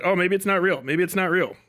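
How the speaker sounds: usual speaking voice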